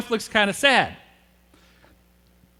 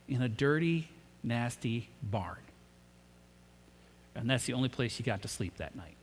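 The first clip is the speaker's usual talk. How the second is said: hushed and somber; 10 semitones lower, 12 dB quieter than usual. Real, not acted. Preacher describing Mary's first night with her newborn, hushed. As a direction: drop almost to a murmur, let the weight sink in.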